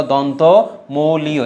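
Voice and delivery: very slow, syllable by syllable দন্তমূলীয়; slow emphasis. Shown as a demonstration. Teacher stressing a term to remember, very slow. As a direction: spell out দন্তমূলীয় slowly so it sinks in. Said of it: "এটা মনে রাখবা".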